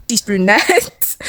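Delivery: very slow, stretched out; drawn-out emphatic. Shown as a demonstration. Telling viewers about the brunette girl, slow drawn-out emphasis. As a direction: draw every word out for effect.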